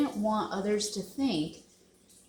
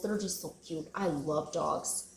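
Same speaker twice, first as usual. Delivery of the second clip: tender hush; 3 semitones lower, 8 dB quieter than usual. Real, not acted. Telling viewers she loves dogs, in a tender near-whisper. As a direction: melt over the animals you love.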